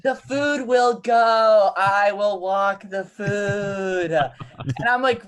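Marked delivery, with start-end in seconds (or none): 0.0-4.1 slow, high, spacey reassurance; blissed-out calm. Retold or imitated. Reed calming the kitchen, slow and spacey. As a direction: soothe the kitchen like you're floating. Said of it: "he's just just like".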